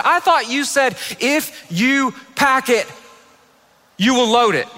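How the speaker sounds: high, slow, word-by-word reminder; deliberate, with loaded pauses; pointed reminder. Retold or imitated. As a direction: imitate a wife holding him to his word. Wife quotes his promise back.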